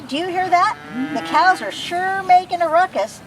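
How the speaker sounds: usual speaking voice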